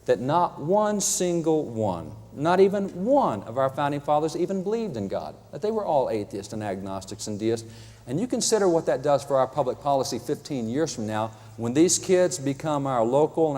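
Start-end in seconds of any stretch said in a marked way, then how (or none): none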